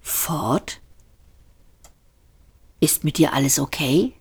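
soft, hushed concern; worried hush. A narrator's reading. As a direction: ask quietly, unsure. Arthur worried.

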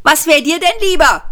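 high, loud shouted retort; shouting back. A narrator's reading. Ford yelling back at the police, loud. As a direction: yell it back defiantly. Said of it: "schrie Ford zurück".

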